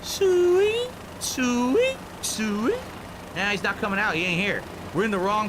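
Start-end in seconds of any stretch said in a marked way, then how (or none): 0.0-2.8 high, rising hog call. Pretend. Searching for the rival, mock hog call. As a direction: call the pigs, pitch swooping up. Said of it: "Do the honk call".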